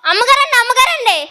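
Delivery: high, loud excited calling. Acted. Maid shouting for her mistress, loud and high. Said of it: "ఎందుకు అంత గట్టిగా అరుస్తున్నావు?".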